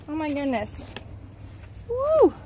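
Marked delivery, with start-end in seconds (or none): 1.9-2.3 high loud whoop of joy; excited whoop. Real, not acted. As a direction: let out a happy woo. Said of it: "Very excited about this".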